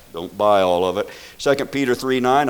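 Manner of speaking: ordinary talk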